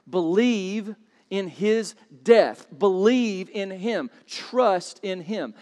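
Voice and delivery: each short phrase drawn out slowly; slow emphasis. Acted. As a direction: lay each word down deliberately. Preacher landing the key point.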